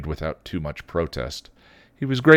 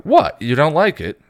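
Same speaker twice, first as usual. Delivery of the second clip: high, loud offended protest; 9 semitones higher, 8 dB louder than usual. A narrator's reading. Spike offended at Buffy, loud protest. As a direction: sound hurt and put out. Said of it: "He looked offended".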